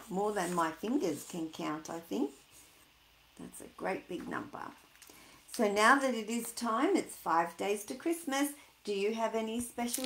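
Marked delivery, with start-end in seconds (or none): 3.4-4.7 unvoiced hushed aside; soft whispered awe. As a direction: breathe it like a secret.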